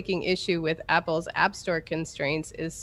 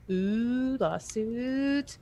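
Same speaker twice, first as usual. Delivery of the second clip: gleeful ooh; 6 semitones higher, 3 dB quieter, much slower than usual. Real, not acted. Gossipy reaction to news.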